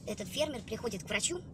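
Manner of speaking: ordinary talk, nothing marked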